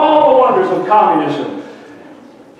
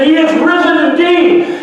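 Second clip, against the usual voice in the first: crowd roaring; 9 semitones higher than usual. Retold or imitated. Thousands at the rally answering the priest. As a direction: roar it like thousands standing. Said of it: "thousands of people stood to their feet".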